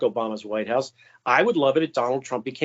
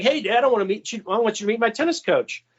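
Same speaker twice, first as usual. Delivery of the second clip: imitating an eager kid, higher and fast; 7 semitones higher, faster than usual. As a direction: rush it like a kid barging in on Dad. Son bursts in on Dad.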